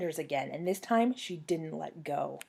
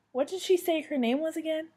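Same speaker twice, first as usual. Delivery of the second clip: higher-pitched, wondering question; puzzled question; 9 semitones higher than usual. A narrator's reading. Cinderella asking fairy godmother the clown's name, puzzled. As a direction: ask as if you can't recall. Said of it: "Fairy godmother shrugged her shoulders".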